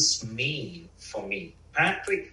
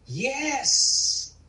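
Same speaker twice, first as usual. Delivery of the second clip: put-on much higher small child voice; small child's yes; 12 semitones higher than usual. The inner child answering the loving parent, high and small. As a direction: answer like a little boy. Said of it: "tell the inner child".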